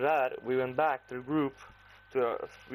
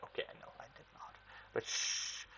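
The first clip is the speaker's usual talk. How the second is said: whisper; 12 dB quieter than usual. Retold or imitated. Friend secretly admits a lie.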